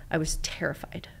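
hushed, half-whispered admission; soft whispered confession. Retold or imitated. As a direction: drop to a whisper on the fear. Recalls fear, whispered.

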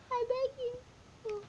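mock begging whine. Real, not acted. Begging sheep to stop.

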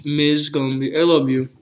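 talking while chewing; speaking between bites; mouth full of sandwich. Real, not acted. Seen 2: Bit sandwich at -4s; still chewing, sandwich held, while speaking.

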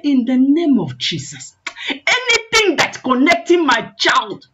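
mother's forceful battle prayer; firing prayer. Retold or imitated. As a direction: build into a high, insistent prayer cry. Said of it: "This woman start firing prayer".

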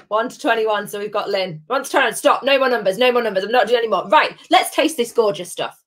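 normal talking voice

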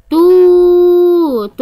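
long held oo in توت; توت said slowly with a sustained too; elongated letter. Shown as a demonstration. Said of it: "حرف التاء ممدود بالواو".

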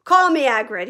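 booming friendly giant; Hagrid's voice. A narrator's reading. Hagrid greeting Harry in a big hearty voice.

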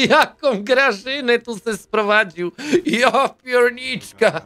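slow words through laughter; real amused laugh, slowed. Real, not acted. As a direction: laugh while getting the words out. Laughing at the game scene.

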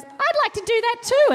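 high mock little-kid whine; whiny little sister. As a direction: imitate the tagalong younger sister begging. Younger sibling whining to join.

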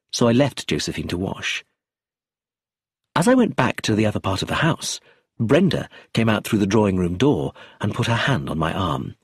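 plain reading voice

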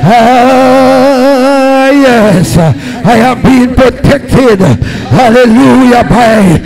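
usual speaking voice

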